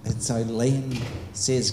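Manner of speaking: normal talking voice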